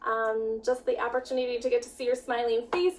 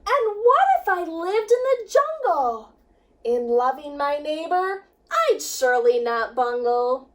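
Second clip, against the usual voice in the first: lively, sing-song storybook reading; rhyming picture book read with energy; reading to children; 4 semitones higher, 4 dB louder than usual. A narrator's reading. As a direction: read it with bounce for the kids. Teacher reads picture book aloud.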